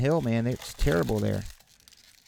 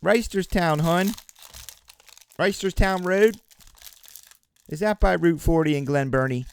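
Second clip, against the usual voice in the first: hammed-up Baltimore accent, higher and louder; playful Baltimore local accent; 6 semitones higher, 4 dB louder than usual. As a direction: put on a thick Baltimore "hun" accent. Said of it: "My buddy does a killer Baltimore accent".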